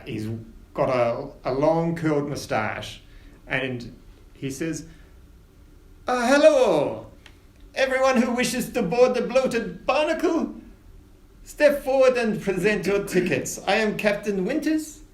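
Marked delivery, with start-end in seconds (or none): 6.1-15.0 ship captain. Acted. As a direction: announce to boarding passengers like a proud captain. GM voices Captain Winters.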